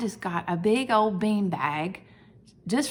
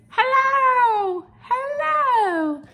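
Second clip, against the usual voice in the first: much higher, louder waving greeting; friendly letter Y; 7 dB louder, much slower than usual. Acted. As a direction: call out hello like a cartoon character. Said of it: "put his arm out waving".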